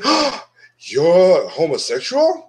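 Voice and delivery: drawn-out, exaggerated gasp of disbelief; hammed-up shocked question; mock shock. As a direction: feign scandalized surprise, stretching the words. Mock shocked reaction he rejects.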